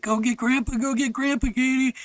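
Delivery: high-pitched retold call for help; urgent plea. Boy in the hole to his sister, urgent retold plea. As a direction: imitate a kid urgently calling up.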